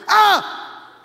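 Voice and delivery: sudden high yelp; a sharp cry as the bird bites; yelp of pain. Retold or imitated. Retelling John's cry when the bird bites his finger, sudden yelp.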